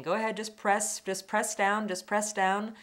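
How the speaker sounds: pressing, encouraging instruction; coaching through it. Retold or imitated. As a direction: imitate someone urging you on, repeating it steadily. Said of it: "sort of talking me through it".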